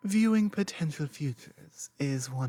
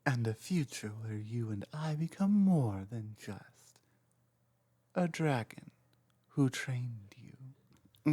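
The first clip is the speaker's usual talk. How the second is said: softer and hushed, tender hint of longing; soft intimate confession; 5 dB quieter than usual. Dragon confessing hopes for a shared future to the trainee, softly intimate.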